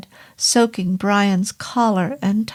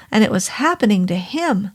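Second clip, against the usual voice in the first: usual speaking voice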